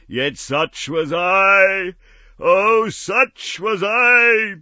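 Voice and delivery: shrieking ghostly despair; anguished wail. Acted. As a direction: howl out your regret. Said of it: "cried the phantom".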